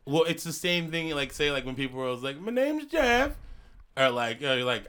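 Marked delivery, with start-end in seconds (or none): 2.4-3.4 high squeaky meme voice. Retold or imitated. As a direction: pitch way up for the meme. Quoting a meme line.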